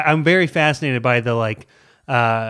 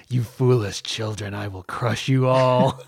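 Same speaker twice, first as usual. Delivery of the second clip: a cold rasping evil emperor. Pretend. Evil AI voiced in a joke.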